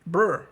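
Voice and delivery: clipped imitation of "bro" as a loud brr; mocking a player's cut-off bro. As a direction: buzz the lips, loud, like a half-said bro. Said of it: "You can't even say bro all the way through".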